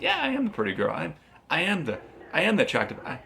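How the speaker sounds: put-on high, preening girly voice; bragging pretty girl.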